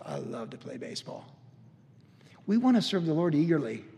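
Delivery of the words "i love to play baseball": a soft whisper, as if confiding a love; hushed whisper. Retold or imitated. Flight attendant confiding his love of baseball, whispered softly. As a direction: breathe it out softly, almost unvoiced. Young athlete's quiet devotion retold.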